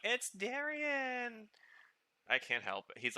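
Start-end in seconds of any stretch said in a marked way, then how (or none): 0.0-1.5 high, slow, squealing recognition of a favorite voice actor; excited squeal. Retold or imitated. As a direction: stretch it out in a delighted high squeal. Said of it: "I'm like".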